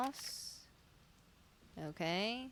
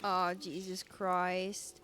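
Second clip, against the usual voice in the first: ordinary talk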